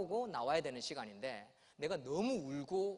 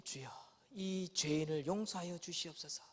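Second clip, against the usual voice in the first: preacher prayer; 3 semitones lower, 4 dB quieter than usual. Retold or imitated. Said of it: "하면서".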